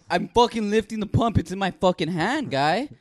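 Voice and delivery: high, loud, fed-up shouting; loud high shout that the pump is already in hand; exasperated yell. Retold or imitated. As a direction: bark it back in disbelief. Angry retort to gas worker.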